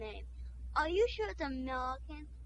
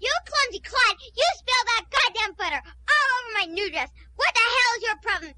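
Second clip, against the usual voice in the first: shrieking rant, much higher and louder; furious outburst; 7 semitones higher, 8 dB louder than usual. Acted. The society lady screams at the guest who spilled butter on her dress. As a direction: drop the posh act and scream at the clumsy guest. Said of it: "what happened to your fancy English accent".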